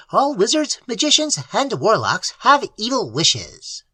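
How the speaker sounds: high imp voice, coolly instructive; calm shrill familiar. Acted. Familiar explaining its duty to Dale, high and cool. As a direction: lecture the master sweetly.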